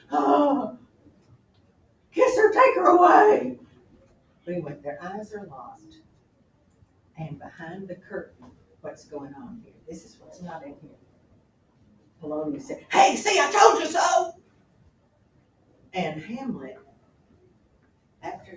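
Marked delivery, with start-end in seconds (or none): 0.1-3.6 high, loud, gushing plea; swooning. Real, not acted. Teacher as audience urging Hamlet, loud swoon. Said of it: "And I'm just". 12.9-14.3 high, loud triumphant cry; gloating shout. Acted. Polonius gloating to the king behind the curtain, shouting.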